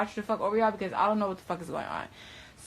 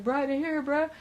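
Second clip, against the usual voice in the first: higher, urgent begging; pleading friend; 8 semitones higher, 3 dB louder than usual. Retold or imitated. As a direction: beg him to back you up. Voicing friend begging the police.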